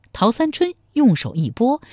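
usual speaking voice

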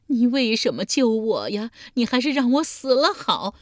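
put-on high, loud wail of an old woman; loud bawling. A narrator's reading. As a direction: bawl it out in despair. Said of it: "嚎啕大哭道". (嚎啕大哭，高声哭喊)

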